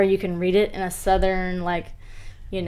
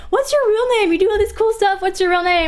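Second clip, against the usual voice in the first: curious fan voice; 10 semitones higher than usual. Retold or imitated. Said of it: "I get messages every day".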